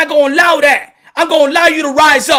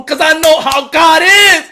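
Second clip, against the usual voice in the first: loud, raised street-preacher rant; passionate preaching; 4 semitones higher, 5 dB louder than usual. Real, not acted. To people who doubt his struggle, shouting preacher rant. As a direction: hammer the hard life at them.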